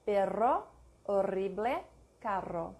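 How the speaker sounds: long trill on the rr of "Perro, horrible, carro"; Spanish trilled rr in "carro"; tongue-rolled double R. Shown as a demonstration. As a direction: roll the tongue through "Perro". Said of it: "a trill or a tongue roll".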